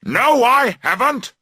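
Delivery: high, loud defiant shout; loud furious denial; angry shout. A narrator's reading. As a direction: yell back in denial. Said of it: "he shouts".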